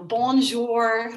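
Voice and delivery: put-on French greeting in a broad Irish accent, drawn out; slow bonjour with heavy Irish vowels; thick Irish accent. Nervous au pair greeting her French host, thick Irish accent. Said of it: "in my very Irish ... thick accent".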